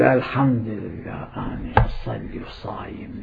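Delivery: mock smug worshipper. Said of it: "إذا تقول".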